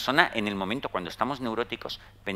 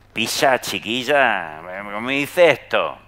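light, slow Andalusian accent, a little louder; 6 dB louder, slower than usual. Shown as a demonstration. Joking with an upset person in a light Andalusian accent. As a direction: drawl it out with a touch of Andalusian accent. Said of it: "puedes empezar a hablar en andaluz".

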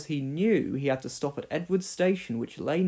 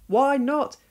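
higher, indignant challenge; demanding; 10 semitones higher, 5 dB louder than usual. Acted. James challenging Gordon and Henry, demanding. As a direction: throw the question back at them. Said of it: "demanded James".

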